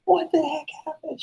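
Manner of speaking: very high falsetto imitation; squeaky-high mock panic; panicked girlfriend. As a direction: mimic a frantic girlfriend in falsetto. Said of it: "She's like".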